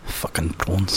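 put-on low, softish South African accent. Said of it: "I can do South African accent".

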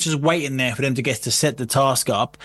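normal talking voice

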